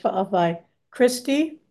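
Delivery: usual speaking voice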